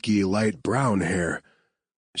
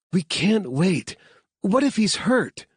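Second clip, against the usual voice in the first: anxious plea; 12 semitones higher than usual. Acted. Claire anxious.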